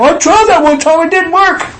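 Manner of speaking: a high, loud imitated skeptic; mock complainer. Preacher imitates a doubter, high and loud. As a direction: mimic a grumbling doubter. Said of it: "and not blame him".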